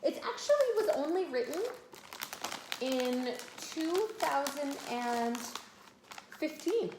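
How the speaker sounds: put-on high, drawn-out amazed tone to a child; wide-eyed storyteller wonder, high and slow; mock surprise. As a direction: play up the surprise, stretching it out slowly. Surprising child about book's age.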